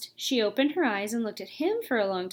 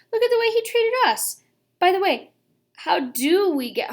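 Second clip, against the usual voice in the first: high, loud indignant outburst; fed up with the uncle; 9 semitones higher, 5 dB louder than usual. Acted.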